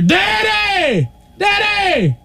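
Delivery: put-on high, drawn-out child's yell; piercing imitation of a calling kid; horrid child's call. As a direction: shriek for your father across the field. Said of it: "the most horrid sound".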